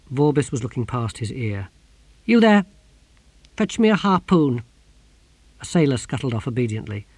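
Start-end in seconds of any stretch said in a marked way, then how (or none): 2.3-4.6 loud, raised-pitch command; calling an order. A narrator's reading. Shouting to a sailor for a harpoon, loud command. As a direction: call across the deck.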